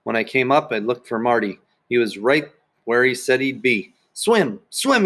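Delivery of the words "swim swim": high-pitched urgent call. A narrator's reading. Swim teacher urging Sheila on, calling out. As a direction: call it out to a swimmer. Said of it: "he called".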